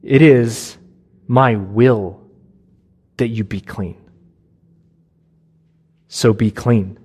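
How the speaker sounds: low, firm declaration; emphatic, authoritative. Shown as a demonstration. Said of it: "This statement is emphatic. It's authoritative".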